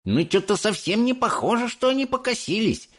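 high-voiced doubtful grumble; discontented grumble. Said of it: "недовольно проворчал Майк Телик". (недовольное ворчание высоким голосом)

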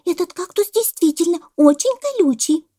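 high, loud squeaky report; monkey voice. A narrator's reading. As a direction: pipe up as an earnest monkey. (голос мартышки)

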